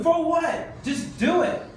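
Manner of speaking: high laughing shout; preacher laughing at lame excuses; laughing exclamation. Real, not acted. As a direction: laugh it out incredulously. Exasperated preacher.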